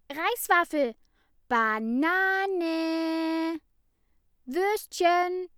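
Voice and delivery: high, loud shouted list, slowing at the end; kids bellowing. A narrator's reading. The children shout their lunches at Ida, loud and chaotic. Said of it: "Die Kinder brüllten durcheinander".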